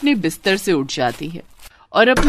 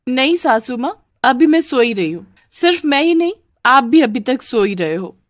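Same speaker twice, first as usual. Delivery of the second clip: put-on higher-pitched young bahu voice; cheeky daughter-in-law; 6 semitones higher, 4 dB louder than usual. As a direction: answer back innocently to the saas. (भोली पर मुँहफट बहू)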